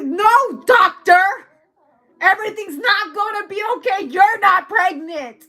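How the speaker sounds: hammed-up panicked protest; freaking out. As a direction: plead and wail, fading as it runs out. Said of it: "someone's freaking out".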